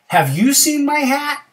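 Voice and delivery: high, loud, eager question; bear asking. Acted. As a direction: call it out high, rising at the end. Bear asks critter.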